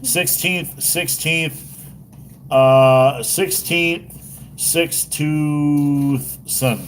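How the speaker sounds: testing "sixteenth" in high, separate tries; tripping over a tongue-twisting name; struggling to pronounce. Real, not acted. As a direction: stumble over "sixteenth", then stretch "six tooth son" slowly. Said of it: "a crazy name to try to pronounce".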